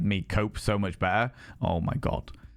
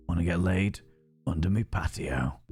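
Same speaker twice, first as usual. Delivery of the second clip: lower, gruff Yorkshire voice; Yorkshire accent; 6 semitones lower than usual. Shown as a demonstration. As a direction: growl it out like a Yorkshire thug. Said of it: "Giving him Chunk's accent".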